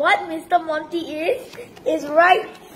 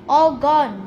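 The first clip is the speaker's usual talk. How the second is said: plain reading voice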